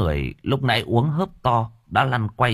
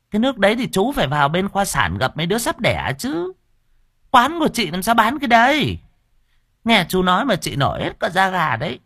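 high, teasing woman's voice; sassy stall woman; 11 semitones higher than usual. Acted. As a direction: scold him playfully, squeamish. Stall owner retorts, flirty. (bà chủ quán đanh đá, giọng cao trêu chọc)

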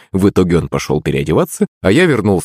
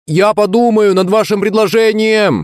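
smirking; 13 semitones higher than usual. Acted. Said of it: "ухмыльнулся".

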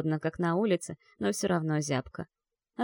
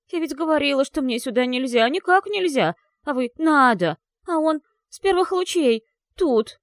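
tearful complaint; 7 semitones higher, 5 dB louder than usual. Acted. Girl near tears, complaining.

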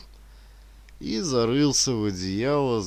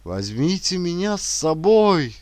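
desperate shout in a dream; 7 semitones higher, 6 dB louder than usual. A narrator's reading. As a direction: call out loud and high for help. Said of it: "кричит Янис". (отчаянный крик)

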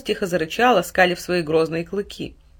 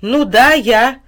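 louder, impatient reply; irritated; 5 semitones higher, 9 dB louder, much slower than usual. A narrator's reading. Mother losing patience with her son, irritated. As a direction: snap back a little. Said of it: "с некоторым раздражением в голосе".

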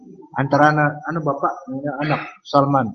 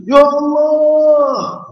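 drawn-out, much higher and louder call; calling out from afar; 11 semitones higher, 8 dB louder, much slower than usual. Retold or imitated. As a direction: call out slowly to someone far away. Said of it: "jauh sehingga kita munfanunadi".

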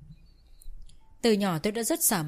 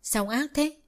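reproachful; 8 semitones higher, slower than usual. A narrator's reading. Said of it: "trách bố chồng".

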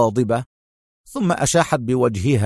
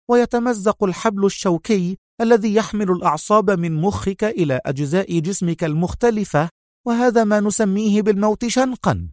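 higher, tightly strung female villain; tense Sonia; 8 semitones higher than usual. A narrator's reading. Sonia tells Adham how hanging kills, tense. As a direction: threaten him through nerves. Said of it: "وقالت في توتر واضح".

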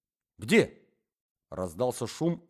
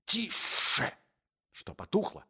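резкий беззвучный шёпот; шикнуть, не давая голоса; 5 semitones higher, 4 dB quieter than usual. Acted. Шепчут у чужой двери. (шёпотом одёргивает спутника у двери)

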